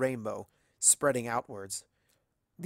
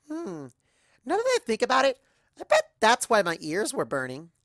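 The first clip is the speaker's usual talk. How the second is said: high, bouncy pony voice, louder; bubbly Pinky; 9 semitones higher, 6 dB louder than usual. Acted. Pinky musing to the narrator, high and bouncy. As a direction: chatter like a giddy party pony.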